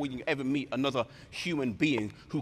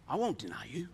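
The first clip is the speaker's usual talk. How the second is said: hushed conviction; 3 semitones lower, 7 dB quieter, faster than usual. Acted. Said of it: "with conviction, with passion".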